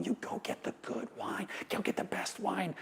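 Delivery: whispering to guests to sneak the good wine; conspiratorial whisper. Acted. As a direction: let the audience in on a secret. Joking conspiracy about stealing wine.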